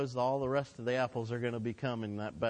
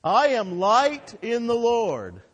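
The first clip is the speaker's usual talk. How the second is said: much higher and louder, slow and ringing; with emphasis and excitement; 9 semitones higher, 10 dB louder, slower than usual. Shown as a demonstration. Pastor leading the congregation to repeat it, excitedly. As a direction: proclaim it with full excitement. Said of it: "emphasis and excitement here".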